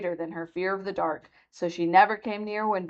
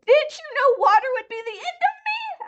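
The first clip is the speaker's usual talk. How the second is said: very high, wailing witch voice; wailing despair; 4 dB louder than usual. A narrator's reading. Dying witch asking Dorothy in a wailing despairing voice. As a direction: wail it out in despair. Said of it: "in a wailing, despairing voice".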